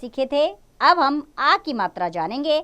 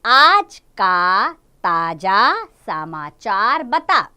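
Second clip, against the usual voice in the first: slow, louder, rhythmic poem reading; reading a poem to children; 3 semitones lower, 4 dB louder, much slower than usual. Acted. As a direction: recite it line by line for kids.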